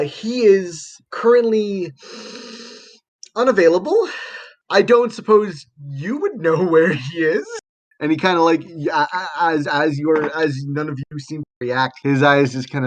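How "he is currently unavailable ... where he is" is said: high, halting, tentative delivery; awkward hesitation. Acted. Lab assistant asking visitors about the missing professor, awkwardly hesitant. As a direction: hedge with long pauses and a raised pitch.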